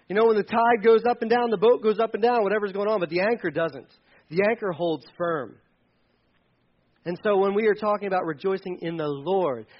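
usual speaking voice

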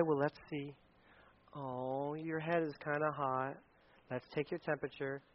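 soft, low, slow concern; caring parent. Acted. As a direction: gently fuss over a sick child, voice dropped. Voicing caring parent checking child.

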